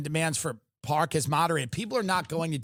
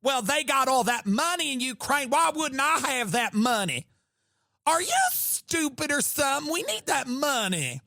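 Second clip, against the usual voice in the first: sassy Southern; 7 semitones higher than usual. Retold or imitated. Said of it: "Lindsey Graham talks like that guy".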